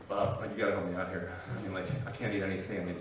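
mildly hushed, low bargaining; hushed plea. Acted. Prisoner asking scary cellmate for help, lightly acted hushed plea. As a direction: keep it low and confidential.

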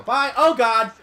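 panicked shouting while fleeing; being chased. Real, not acted. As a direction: yell in a panic, running for your life.

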